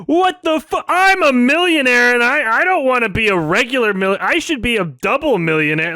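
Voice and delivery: high, loud whiny mock of a greedy rapper. Streamer mocking the rapper's greed, high and whiny. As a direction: sneer it out as a spoiled millionaire brat. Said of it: "that was him being like".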